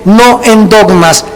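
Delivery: ordinary talk, nothing marked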